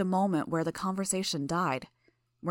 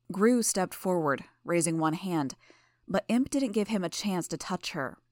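ordinary delivery, nothing marked

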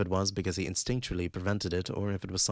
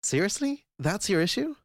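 incredulous, much higher pitched; irritated high pitch; 12 semitones higher, 3 dB louder than usual. Acted. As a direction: pitch shoots way up in irritated disbelief. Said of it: "Emma's voice rose in pitch, irritated".

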